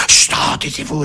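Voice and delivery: sharp hiss for silence; annoyed shushing. Acted. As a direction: shush a noisy latecomer. Spectator shushes noisy latecomer.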